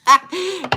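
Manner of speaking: very high, loud shriek of laughter; cracking up. Real, not acted. Streamer laughs at "pull out", high loud cackle. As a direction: explode into a real laugh. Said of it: "I'm cracking up".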